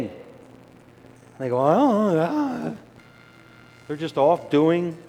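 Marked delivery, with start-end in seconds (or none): 1.6-2.7 put-on high, vague little-kid noise; distracted child. Child answering parent absently, high and vague. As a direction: play the kid who isn't listening.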